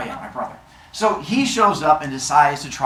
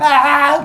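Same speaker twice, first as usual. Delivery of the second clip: loud, high mindless scream; open-throated high yell; goofy yell; 11 semitones higher, 7 dB louder than usual. Acted. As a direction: holler like a fool running past. Man runs out yelling.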